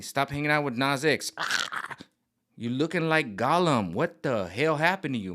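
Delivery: ordinary talk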